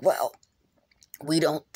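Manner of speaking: ordinary talk, nothing marked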